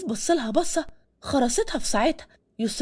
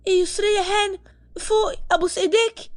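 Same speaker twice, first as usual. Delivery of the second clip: higher-pitched alarmed plea to the fainted lady; panicked cry; 8 semitones higher than usual. Acted. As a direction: call out to wake her, acted panic. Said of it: "ملك صرخت". (صرخة فزع تمثيلية بصوت أعلى)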